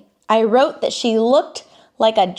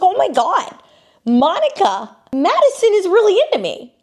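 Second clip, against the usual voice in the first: high, gushing imitation of self-flattery; mock giddy boasting; 10 semitones higher, 4 dB louder than usual. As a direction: squeal it like a smitten fool. Mocking Joey's boastful delusion.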